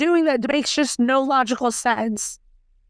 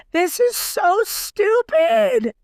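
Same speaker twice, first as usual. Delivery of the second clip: exasperated outburst; 11 semitones higher, much slower than usual. Real, not acted. Exasperated rant about storyline.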